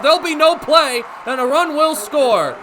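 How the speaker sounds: normal talking voice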